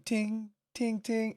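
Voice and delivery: high, soft imitated tings; dropped spoon sound. Imagining Ash's spoon hitting the table, high soft tings. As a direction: ring it lightly like falling cutlery. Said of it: "drop the spoon".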